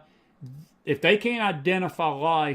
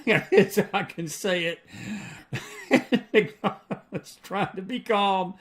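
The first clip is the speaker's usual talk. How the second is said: laughing through the words, slow; a real exasperated laugh while talking; 4 semitones higher, slower than usual. Real, not acted. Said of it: "I'm trying to stay calm".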